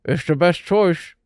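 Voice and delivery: slow, garbled full-mouth speech; peanut butter mush mouth. A narrator's reading. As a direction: talk through a sticky mouthful of sandwich. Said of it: "said in a peanut butter mush mouth".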